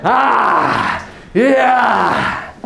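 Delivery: high yell falling away; ecstatic release. Acted. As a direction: cry out in rapture, then let it drop. Acts out release after connection.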